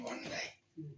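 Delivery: dreamy whisper of heaven. Real, not acted. Preacher to congregation, whispering of the heavenly mansion. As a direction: breathe it like a secret hope. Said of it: "unvoiced whisper".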